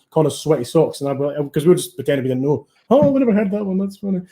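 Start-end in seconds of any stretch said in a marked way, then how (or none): 2.9-4.2 lightly put-on London accent, pitched higher; mock London accent, playing innocent. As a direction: mimic a Londoner, voice lifted. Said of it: "a London accent".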